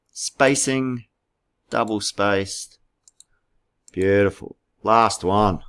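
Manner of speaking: ordinary delivery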